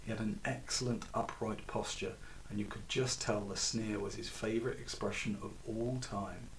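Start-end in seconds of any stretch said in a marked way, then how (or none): none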